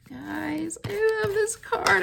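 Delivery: high-pitched excited gush; gushing affection. Real, not acted. Crafter telling viewers about her card, high gushing. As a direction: gush it high to your fans.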